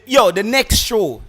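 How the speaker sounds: loud slow call to the audience. Real, not acted. Host announcing to listeners that calls return, loud and slow. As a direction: raise the voice to grab the listeners.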